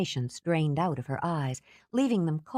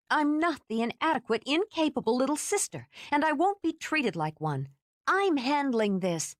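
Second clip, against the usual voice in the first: Kate angry; 10 semitones higher than usual. Acted. Kate angry, rising to fight.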